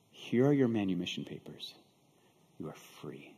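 low, hushed and solemn; quiet, measured pronouncement; gentle announcement. Acted. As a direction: softly hand over the news, fading to near whisper. Buyer gently hands over freedom papers.

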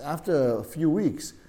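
ordinary talk

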